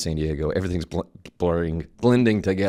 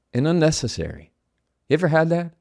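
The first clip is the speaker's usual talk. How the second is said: ordinary talk, nothing marked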